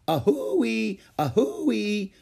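high two-note locomotive wail; wailing train whistle. A narrator's reading. Ramona playing the locomotive, high wailing whistle. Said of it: "wailed Ramona".

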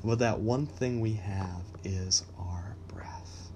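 hushed and slow, almost breathless; whispered. Real, not acted. Host confiding to listeners that breath is constant, whispering. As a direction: drop to a whisper to land the point. Intimate point about breath.

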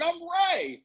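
very high, excited exclamation; excited reveal. Retold or imitated. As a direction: reenact an overjoyed reveal to an old friend. Retold excited self-introduction to classmate.

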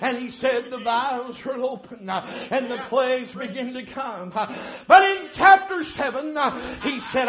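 normal talking voice

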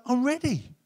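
God welcoming the returning son, eager; eager welcome. Acted. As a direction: announce you're ready to welcome him. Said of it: "and God's like".

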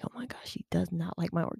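hushed anxious whisper to herself; breathless whispered inner panic; whispered worry. Retold or imitated. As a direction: whisper it like a secret fear.

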